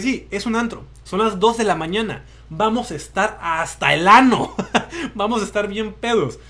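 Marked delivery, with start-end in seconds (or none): 2.5-6.4 laughing. Real, not acted. Jokes about drunk club night.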